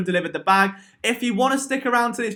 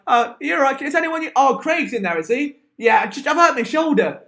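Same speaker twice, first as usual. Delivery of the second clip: higher, awkward acted exchange; flustered bloke caught out; 6 semitones higher than usual. Walking in on someone at the urinal, flustered. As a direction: play someone fumbling an excuse, pitched up.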